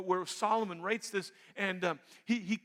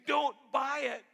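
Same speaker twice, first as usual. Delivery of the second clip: louder and higher, a sharp command; firm shout; 6 semitones higher, 5 dB louder, slower than usual. Acted. Preacher voicing wisdom shouting at a shopper, firm shout. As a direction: bark the warning like a stern conscience.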